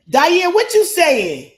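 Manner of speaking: loud, high, slow call across the room. Real, not acted. To Diane laughing off camera, a slow loud call. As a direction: call out to her to find out why she laughs.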